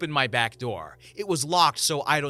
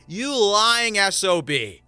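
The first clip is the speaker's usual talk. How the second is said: slow, loud and higher, spitting each word; angry insult; 5 semitones higher, 5 dB louder, slower than usual. Acted. Homeowner insulting the intruder slowly, loud and higher. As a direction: lash out at the liar.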